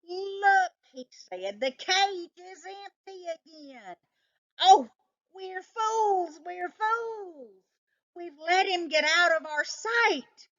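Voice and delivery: high, frantic alarm; panicked yelp. A narrator's reading. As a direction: cry out in sudden panic. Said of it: "Chester let out a yelp".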